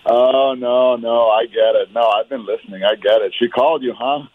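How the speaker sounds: normal talking voice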